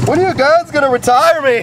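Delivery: high-pitched wail of a worn-out machine; Rhino. Acted. Voicing the poor Rhino begging to retire, high mock wail. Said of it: "Poor Rhino, like".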